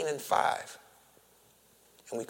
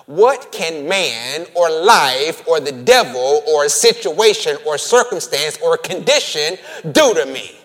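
louder, defiant rhetorical challenge; triumphant preacher's cry; preaching at full force; 9 dB louder than usual. Acted. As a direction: throw the question at the devil.

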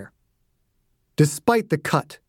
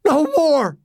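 put-on much higher, childlike cry; stammering plea; 13 semitones higher than usual. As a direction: beg like a scared boy. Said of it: "Dossie stammered".

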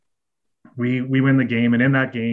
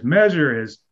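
deadpan demand; 3 semitones higher, much slower than usual. Retold or imitated. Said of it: "all you hear is".

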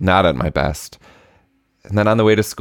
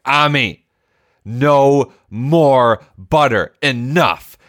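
slow, high, firm no more butter; a stern dad drawing the line, slow and raised; stern scolding; 8 semitones higher, 3 dB louder, much slower than usual. Retold or imitated. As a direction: lay down the law to a child. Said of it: "I was monstrous in that moment".

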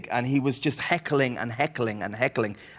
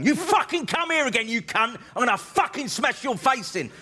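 high-pitched enraged threat; screamed abuse; 10 semitones higher than usual. Retold or imitated. Guy threatening the comic coming off stage, screaming abuse. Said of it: "screaming abuse at you".

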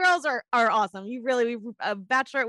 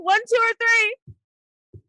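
game show host; 12 semitones higher, 4 dB louder, much slower than usual. Acted. Host drawing out the choices.

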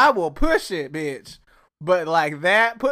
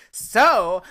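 imitating Tanya; 6 semitones higher, 6 dB louder, slower than usual. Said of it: "shout out to Tanya for saying".

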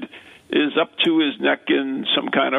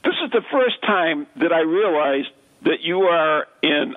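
ordinary talk

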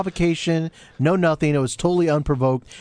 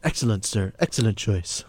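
lower, softer posh-waiter voice; mock waiter; 10 semitones lower, 4 dB quieter than usual. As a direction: play the deferential waiter, pitched down. Playing the waiter taking order.

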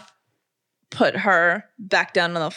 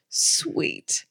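choked whisper; 5 dB quieter than usual. Real, not acted. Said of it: "I'm gonna cry".

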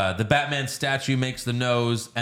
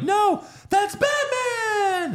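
put-on much higher, slow, thrilled kid's cry; excited orphan kid; much slower than usual. Orphan kid spots Batman flying past, high slow excited cry. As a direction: squeal it like an awestruck child. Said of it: "that one orphan kid's like".

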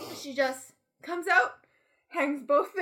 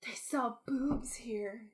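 "They sell boobs here" whispered without voice; hushed whispered retelling of the kid; 4 semitones lower, 8 dB quieter, slower than usual. Retold or imitated. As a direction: breathe the line in a hush. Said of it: "I just burst out laughing".

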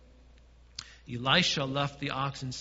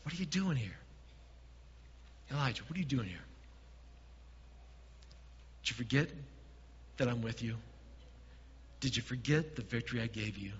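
softer, lower, gentle questioning; 4 semitones lower, 7 dB quieter than usual. Retold or imitated. God asks Elijah gently in the cave, softly. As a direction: ask him gently, almost hushed. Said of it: "asked him twice".

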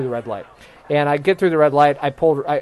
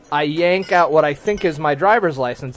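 ordinary talk

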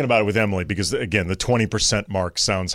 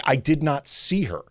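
usual speaking voice

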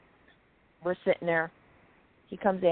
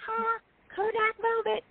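squeaky falsetto, much higher; mock squeal. Grinning at [name], teasing him in a high squeaky falsetto. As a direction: squeal it out like a goofy fan posing for a photo. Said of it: "And I'm like".

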